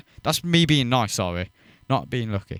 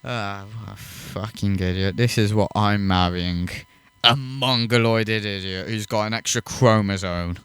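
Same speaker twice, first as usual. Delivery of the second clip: subdued, lower-pitched mimicry of a fed-up partner; imitated exasperated partner; 5 semitones lower than usual. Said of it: "just going".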